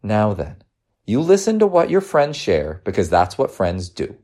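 gentle motherly lesson; reassuring mother. Acted. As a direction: counsel a child patiently.

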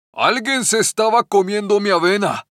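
lightly voiced indignant big bear; the big bear finds his porridge eaten; big bear exclaiming. A narrator's reading. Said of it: "exclamó el oso grandote". (oso grande indignado, exclamación suave)

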